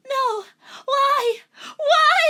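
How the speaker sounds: building very high, loud hammed-up wails; mock anguished wail. Rachel despairing over the pills, rising wail.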